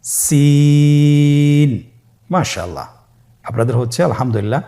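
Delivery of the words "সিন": সিন with the vowel held three counts, thin and light; Arabic letter lengthened for learners; three alif stretch. Shown as a demonstration. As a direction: draw সিন out long. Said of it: "আবার বলুন".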